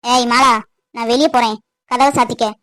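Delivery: low, curt "ஏய் Mala" barked at his wife; lightly replaying his rough tone: "நான் வெளியே போறேன், கதவை சாத்திக்க" as a blunt order; gruff husband calling out to his wife. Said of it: "இப்படிதான் மாப்பிள சொன்னேன்".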